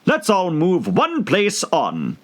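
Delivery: high, slow bossy hatter. A narrator's reading. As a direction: announce it like the Hatter. Hatter voice in story.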